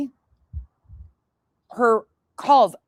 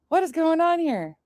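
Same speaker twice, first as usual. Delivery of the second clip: what lifted higher, puzzled; higher mock-bewildered repeat; 7 semitones higher than usual. Retelling her confusion at the call, pitched higher. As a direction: ask it again higher, baffled. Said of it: "At first, I was just like".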